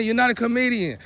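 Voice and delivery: ordinary delivery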